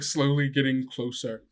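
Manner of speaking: ordinary delivery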